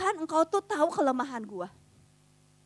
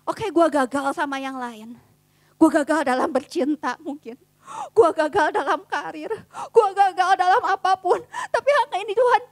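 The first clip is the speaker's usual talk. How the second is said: tearful pleading prayer; 6 dB louder than usual. Acted. Sample prayer, building to crying.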